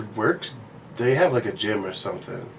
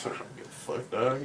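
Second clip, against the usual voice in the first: much softer, half-whispered shrug; retold apathy; 10 dB quieter than usual. Man imitating himself without a trainer, quiet apathy. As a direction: toss it off under your breath, mock indifference. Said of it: "Without that, I'm like".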